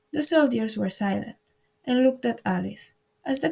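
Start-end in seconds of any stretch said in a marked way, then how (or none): none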